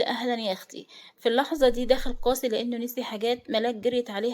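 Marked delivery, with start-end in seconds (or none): none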